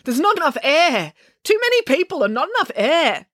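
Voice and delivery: high, panicky gasping for air; mounting panic, pitched well up; laboured, panicky. A narrator's reading. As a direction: plead as if suffocating. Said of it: "Her breathing was becoming laboured".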